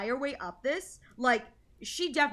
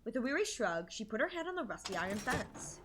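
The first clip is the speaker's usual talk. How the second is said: soft, quiet story reading; story narration; 9 dB quieter than usual. A narrator's reading. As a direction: read it gently like a novel. Reads the game narration.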